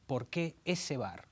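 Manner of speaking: usual speaking voice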